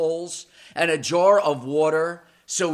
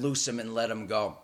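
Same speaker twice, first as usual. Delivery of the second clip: soft command; 7 semitones lower, 8 dB quieter than usual. Acted. Jesus frees Lazarus.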